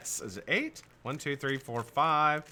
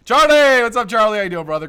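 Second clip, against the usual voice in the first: high, loud holler of welcome; shouted greeting; 11 semitones higher, 13 dB louder than usual. Real, not acted. Host hailing an arriving viewer, loud excited call. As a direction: call out a name across the room.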